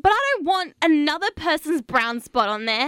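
high, fussy woman's voice; high girly voice. Acted. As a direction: fret in a high girly squeak. Wendy upset about G-string.

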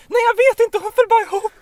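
put-on high, loud, anxious young woman's voice; worried bystander woman. Bystander to a fallen cyclist, high and anxious. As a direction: play the stranger bent over you, worried. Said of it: "hör en röst som säger".

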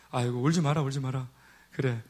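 low, soft consoling murmur; 달래는 말투. Retold or imitated. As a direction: soothe a crying caller, very softly. Pastor soothing a crying missionary on phone. (낮고 부드럽게 달래듯이)

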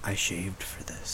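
slow sultry whisper. Pretend. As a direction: breathe it low and seductive. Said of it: "Bob, give us a sultry voice".

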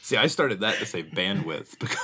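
loud, laughing explanation; amused, laughter bubbling into the words. Real, not acted. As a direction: talk through real laughter. Joking about video, laughing along.

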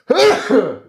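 sudden sharp sneeze, high and loud; sneezing. Real, not acted. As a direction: let a real sneeze burst out. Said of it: "ich muss niesen".

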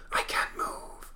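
helpless spectral whisper; voiceless whisper; ghostly whisper. Acted. Spectre pinned by power bolts.

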